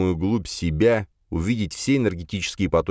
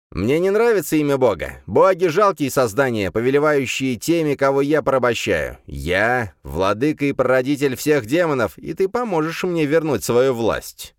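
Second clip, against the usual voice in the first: booming arrogant overlord; 6 semitones higher than usual. Acted. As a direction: boom out your contempt for gods. Demon lord boasts.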